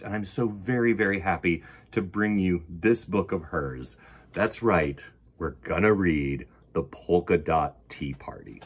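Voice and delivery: ordinary delivery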